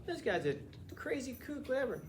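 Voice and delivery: mock dismissive reader; dismissive scoff. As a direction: wave him off as a kook. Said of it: "you look at it and go".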